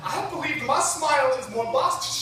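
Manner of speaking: impassioned slam poetry. Acted. Poet accusing the mentor who silenced them, impassioned spoken-word climax.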